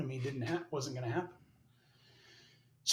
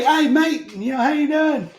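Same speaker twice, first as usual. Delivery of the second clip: put-on loud, high Aussie-Kiwi accent; New Zealand accent; 10 semitones higher, 6 dB louder than usual. Sergeant major greets his old buddy, New Zealand accent. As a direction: imitate a Kiwi mate's hearty hello. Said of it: "like, New Zealand, um, accent".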